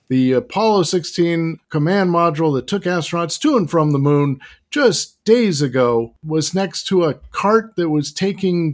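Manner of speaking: 1940s newsreel announcer voice; mid-Atlantic accent. Pretend. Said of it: "with a mid-Atlantic accent, like a nineteen forties era news flash".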